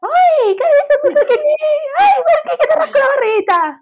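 put-on very high, squeaky baby voice to a pet; cooing pet talk. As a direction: gush over a puppy like a baby. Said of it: "tú le hablarías". (voz muy aguda de hablarle a una mascota)

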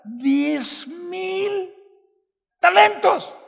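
rising emphasis. Real, not acted. Stressing the huge debt.

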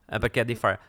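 usual speaking voice

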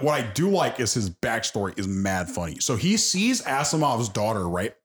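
normal talking voice

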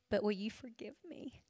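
imitating a man choking back tears as he asks forgiveness; choked up. Ex-husband asks dying mother-in-law for forgiveness, voice choked. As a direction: voice catching, dropping to a hushed plea. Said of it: "His voice choked".

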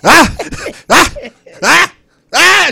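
loud high repeated fake screams. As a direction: scream falsely, loud enough. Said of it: "He lied just loud enough".

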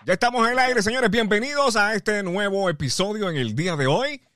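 host welcome. Acted.

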